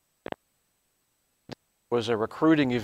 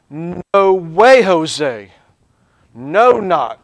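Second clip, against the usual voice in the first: "No way" punched very loud, "No, not" just as hard; emphatic refusal; 3 semitones higher, 10 dB louder, much slower than usual. Retold or imitated. Teacher paraphrasing the Greek double negative, emphatic "No". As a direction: hit each "No" hard and loud. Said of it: "he doubles down on that".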